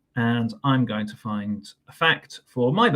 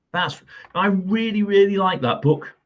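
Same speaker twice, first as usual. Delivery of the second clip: high, louder gushing about the book; bursting with love for the book; excited; 8 semitones higher, 6 dB louder than usual. Real, not acted. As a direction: gush with real delight. Enthusing about a favourite book.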